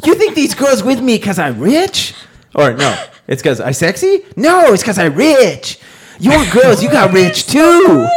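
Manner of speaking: put-on high, loud infomercial pitchman accent; hammed-up infomercial pitchman with a Vietnamese accent; Tom Vu. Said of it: "I literally had Tom Vu, infomercial guy".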